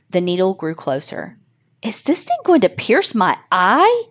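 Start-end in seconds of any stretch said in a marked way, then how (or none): 1.8-4.0 high, rising panicked question; alarmed. A narrator's reading. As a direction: blurt it out in alarm. Needle approaching his eye.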